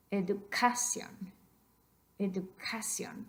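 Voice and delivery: educación stressed on ca, the second to last syllable; Educación said slowly with the voice landing on ca; wrong stress educación. Shown as a demonstration. Teacher demonstrates the tempting wrong stress to the students. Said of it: "We do not want that".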